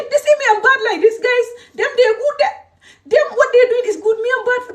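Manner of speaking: high-pitched indignant protest; angry. Real, not acted. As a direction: protest hotly, voice climbing way up. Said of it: "these people make me angry".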